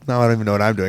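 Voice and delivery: ordinary talk, nothing marked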